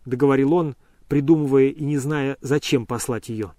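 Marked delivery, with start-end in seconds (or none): none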